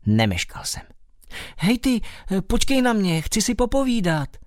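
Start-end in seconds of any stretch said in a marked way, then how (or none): 1.6-4.4 high, eager call after the swimming creature. Acted. Bat calls out to the strange mole, high and eager. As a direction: shout up to make him wait. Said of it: "volal jsem".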